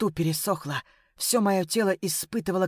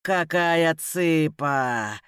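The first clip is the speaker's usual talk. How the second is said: put-on slow, drawn-out drunken leer; sleazy slow catcall; 3 semitones lower, much slower than usual. A narrator's reading. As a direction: drag the words out like a leering drunk. Drunk man leers at her.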